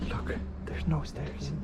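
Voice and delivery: slow hushed whisper; nervous night vlog whisper. Real, not acted. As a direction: whisper it slowly. Inside near security.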